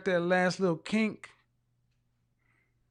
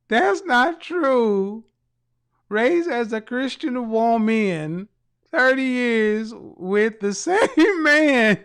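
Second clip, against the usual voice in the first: slow, drawn-out, higher and louder mocking voice, laughing at the end; mocking quote; 3 semitones higher, 6 dB louder, much slower than usual. Host mocking caller Karen's comment, slow drawn-out mimicry. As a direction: stretch every word out to ridicule her. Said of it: "She said".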